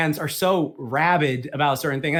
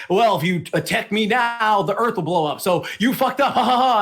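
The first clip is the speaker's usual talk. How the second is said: higher-pitched taunt breaking into a mocking laugh; hammed-up villain threat; mock villain gloating; 5 semitones higher than usual. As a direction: gloat like a cartoon villain, ending on ha-ha-ha. Villain taunts Goku in retold scene.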